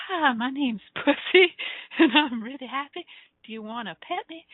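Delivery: high-pitched, playful Southern belle voice; Southern belle character. Acted. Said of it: "my pussy actually has its own voice".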